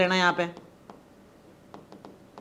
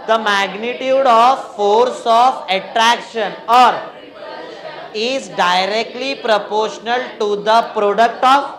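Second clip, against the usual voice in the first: loud, very slow, stretched-out chant; drawn-out syllables, left hanging for students to finish; class recitation; 5 dB louder, much slower than usual. Real, not acted. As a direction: lead the class word by word. Class repeats statement from the start.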